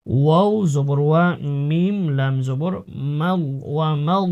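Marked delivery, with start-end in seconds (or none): none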